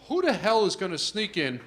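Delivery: loud, high, disbelieving rant; loud exasperated thought. Retold or imitated. Thinking about the photo ID rule, in a loud exasperated rant. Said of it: "And I'm thinking".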